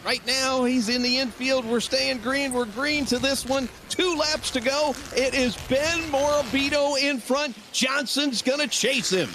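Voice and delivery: high, fast play-by-play over the finish; excited race call. Acted. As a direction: call the last laps like it's the win.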